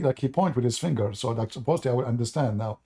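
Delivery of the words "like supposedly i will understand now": ordinary talk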